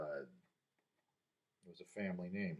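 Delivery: ordinary delivery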